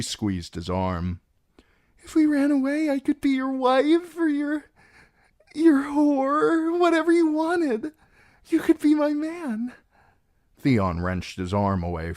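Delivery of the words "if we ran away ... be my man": put-on high, pleading girl voice; young bride voice, desperate. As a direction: beg him to run away with you.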